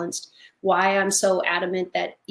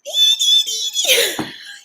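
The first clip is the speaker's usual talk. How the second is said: loud high burst of laughter; big laugh; 4 dB louder than usual. Real, not acted. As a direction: crack up at the memory. Laughing at screaming guinea pig.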